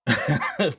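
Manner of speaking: burst of laughter. Real, not acted. Laughing at chat.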